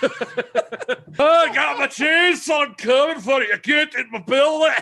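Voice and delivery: put-on high, slow, shouted Scottish accent. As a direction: bellow a joke threat in thick Scottish. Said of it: "No, you went Scottish".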